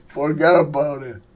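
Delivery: mock mobster through a laugh; lightly imitated Italian mobster; Al Pacino. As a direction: toss it off like an amused film gangster. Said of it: "This is my impersonation of Al Pacino".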